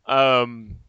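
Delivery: ordinary talk, nothing marked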